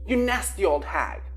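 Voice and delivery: loud exasperated scolding. A narrator's reading. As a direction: tell her off loudly. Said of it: "Lil reprimanded".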